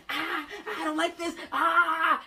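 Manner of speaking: high, laughing, grossed-out panic; disgusted panicked whine; panicked squeal. Retold or imitated. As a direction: squeal like you just got slimed. Panicking over condom.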